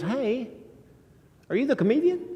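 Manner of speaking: high-pitched imitation of a curious fan; imitated woman's greeting. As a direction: lift your voice high to greet as her.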